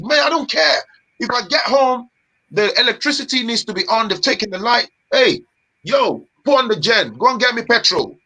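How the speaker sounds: ordinary delivery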